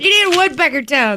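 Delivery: fast, laughing and shouting. Real, not acted. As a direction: blurt the joke mid-laugh. Joking amid laughter.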